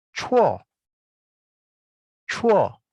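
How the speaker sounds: chuo high and falling sharply. Shown as a demonstration. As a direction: chuo tinggi turun tajam. (chuo diucapkan nada turun dari tinggi)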